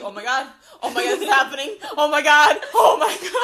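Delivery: laughing mock panic rising to a high loud squeal; a guest watching the bride walk down the aisle, spiralling into laughing panic; panicking. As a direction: build from nervous to frantic. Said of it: "I would literally be sweating bullets".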